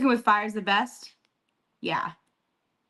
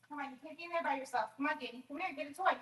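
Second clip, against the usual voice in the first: higher, soft, quick coaxing; coaxing the dog; 6 semitones higher, 11 dB quieter, faster than usual. Real, not acted. Owner coaxing her dog Danny out of hiding, soft and high. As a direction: sweet-talk the dog out of there, fast and hushed.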